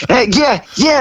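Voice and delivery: high, bouncy yeahs; hyped and jumpy; hyper. Retold or imitated. Singer answering Dick Clark, high and hyper. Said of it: "and I'm all hyper".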